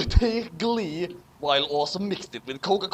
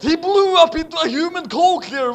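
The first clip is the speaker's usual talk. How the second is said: high, loud narration; explosive punchline; 12 semitones higher, 8 dB louder than usual. A narrator's reading. Narrator describing the explosion, high and loud. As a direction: burst into the climax.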